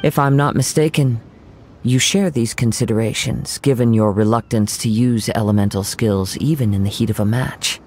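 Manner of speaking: usual speaking voice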